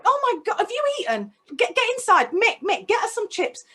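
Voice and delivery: high, urgent, fussing questions; a kind man alarmed to find a child alone; worried stranger. Retold or imitated. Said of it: "and says".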